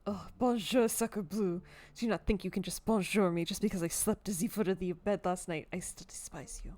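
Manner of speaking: put-on haughty cat with a loose French accent; half-hearted French accent. Cat scolding its owner's guest, half-hearted French accent. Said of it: "I'm not trying that hard in my French accent".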